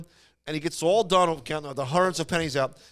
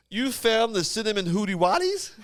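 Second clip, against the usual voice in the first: higher, rising puzzled question; baffled father; 5 semitones higher than usual. Retold or imitated. Father with no idea what the kid meant, baffled mock question. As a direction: ask it like a dad who misheard.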